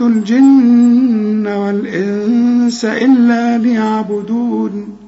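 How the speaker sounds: slow melodic tajweed chant; measured, sustained recitation on a level tone; Quran recitation. Acted. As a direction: chant the verses with long held vowels and hums. Recites the verses for memorising.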